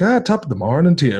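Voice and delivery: mock Hollywood Irish lilt; bad stage Irish accent. Mocking old film accents, bad stage Irish accent. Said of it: "really bad kind of, like".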